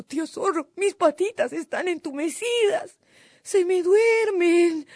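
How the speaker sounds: very high, pleading wail; hammed-up helpless complaint; whining little cuy. A narrator's reading. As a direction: whine that you can't hold on, stretching the last words. Cuy fakes exhaustion to fool fox.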